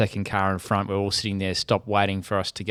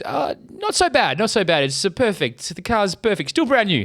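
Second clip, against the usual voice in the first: squeaky imitation brushing off the damage; mocking the other driver; 10 semitones higher than usual. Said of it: "He goes, um".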